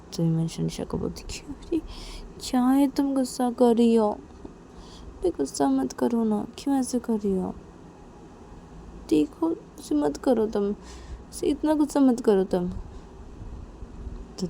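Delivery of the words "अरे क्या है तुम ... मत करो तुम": higher, drawn-out coaxing; pleading appeasement. Acted. [name] begging Nisha not to be angry, pleading. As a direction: plead slowly, keeping the volume down.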